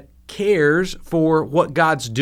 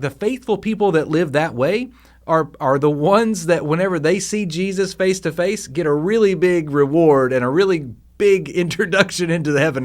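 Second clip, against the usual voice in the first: normal talking voice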